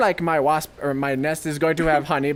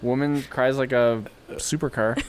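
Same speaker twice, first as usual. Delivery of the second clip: ordinary talk, nothing marked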